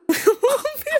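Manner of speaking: a very high, rising squeal of a laugh; high squeal of laughter. Real, not acted. Cracking up at her own joke, a high rising laugh.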